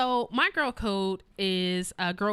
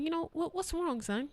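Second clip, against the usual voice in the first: gentle mother; 9 semitones higher, 4 dB quieter than usual. Retold or imitated. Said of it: "So I'm like".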